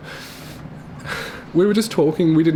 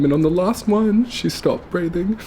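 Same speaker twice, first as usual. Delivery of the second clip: high, slow, tearful voice breaking; barely getting it out through tears; crying; 5 semitones higher than usual. Real, not acted. Retelling Mum's last breath at the hospital, crying. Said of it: "I just end up crying so much".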